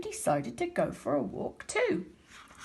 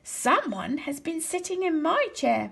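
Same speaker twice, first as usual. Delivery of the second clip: Mama Bear, higher, in a mild wail; Mama Bear wailing about her chair in a higher voice; 7 semitones higher than usual. A narrator's reading. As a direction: wail it like a fussing bear mother. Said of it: "Mama Bear wailed in her medium-sized voice".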